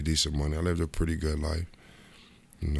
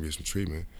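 normal talking voice

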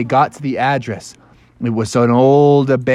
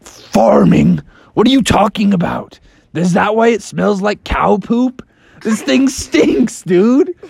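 incredulous Batman; 8 semitones higher than usual. Acted. Batman incredulous at farm smell.

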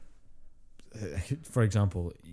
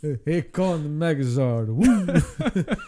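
slow, high, booming announcer call; hype announcer; 6 semitones higher, 5 dB louder, much slower than usual. Pretend. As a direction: announce the giant robot like a cartoon promo. Hyping the Power Rangers robot.

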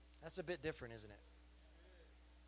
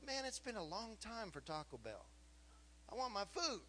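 impatient thought; 5 semitones higher, 4 dB quieter than usual. Retold or imitated. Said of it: "I'm thinking to myself".

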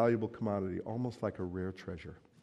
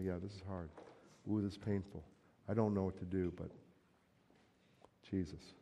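quiet resolve; 3 semitones lower, 8 dB quieter than usual. Pretend. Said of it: "You go".

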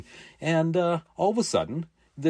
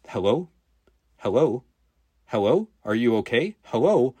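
mock operator calling out from a distant phone, a bit louder; far off phone voice; 4 dB louder than usual. The 911 operator calls from the distant phone, asking hello. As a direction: call hello as if from a phone across the sofa. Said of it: "in the distance a little".

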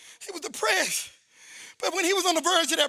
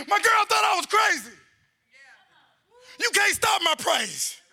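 high, driving testimony shouted to the congregation; full-force preaching; 7 semitones higher than usual. Real, not acted. Preacher testifying to the church about being set free, full-force preaching. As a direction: preach it in short, pitched-up bursts.

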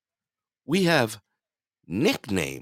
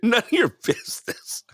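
high, squeaky mimic of the retort; high mocking echo. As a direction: parrot it back in a high squeak.

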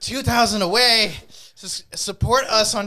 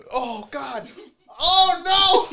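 queasy after a burp; 9 semitones higher, much slower than usual. Real, not acted. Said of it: "You burped".

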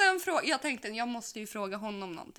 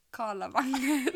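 laughing; 3 semitones higher, 4 dB louder, much slower than usual. Retold or imitated. Her answer about her star sign.

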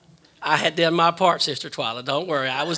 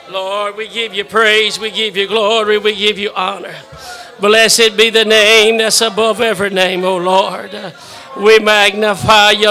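fervent prayer; 7 dB louder than usual. Acted. Pastor leads prayer.

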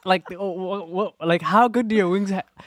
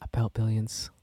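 sultry perfume ad; 6 semitones lower, 5 dB quieter than usual. Acted. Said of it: "you sound like a perfume commercial fountain".

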